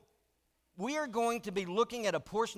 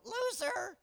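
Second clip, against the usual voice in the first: much higher squawking parrot; 12 semitones higher than usual. Retold or imitated. Parrot insulting the husband, high squawky mock. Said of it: "the bird would go".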